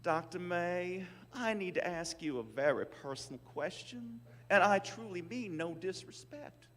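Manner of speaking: imitating a polite Southern saleswoman's accent. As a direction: mimic her Southern accent, slow and careful. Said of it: "in her heavy Southern accent".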